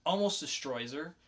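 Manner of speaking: ordinary delivery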